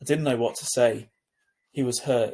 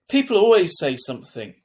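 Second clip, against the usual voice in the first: a hint of hurt bitterness, raised and loud; bitter; 6 semitones higher, 9 dB louder than usual. A narrator's reading. Hurt boy answers his sister bitterly, louder. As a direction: spit it out, bitter and wounded. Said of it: "he answered bitterly".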